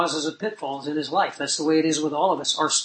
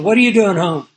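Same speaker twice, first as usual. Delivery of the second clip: a louder mock version of dad's demand; spiced-up retort; 5 dB louder than usual. Retelling dad's angrier version to the congregation, mock loud. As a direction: bark it the way dad really said it, hammed up a touch. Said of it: "he spiced it up a bit".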